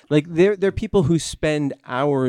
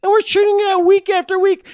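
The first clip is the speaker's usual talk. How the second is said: mock proud exclaim; 12 semitones higher than usual. Joking boast about weekly output.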